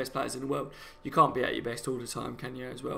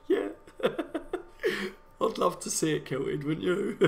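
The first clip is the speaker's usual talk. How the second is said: slow words through laughter; giggling while talking; laughing; 6 semitones higher, much slower than usual. Real, not acted. Laughing at viewer's joke.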